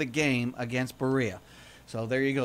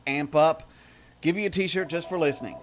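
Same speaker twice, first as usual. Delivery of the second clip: ordinary talk, nothing marked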